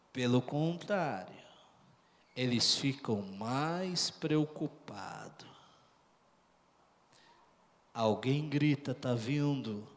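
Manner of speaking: low, very soft suspense; sudden drop to a near-hush, cries only reported softly; hushed preaching. Real, not acted. Preacher tells of frightened disciples seeing a figure, hushed and low.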